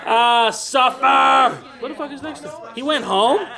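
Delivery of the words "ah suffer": very drawn-out, high, loud cry; gloating shout. Pretend. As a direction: shout it slowly, relishing the torment. Gloating over his game character.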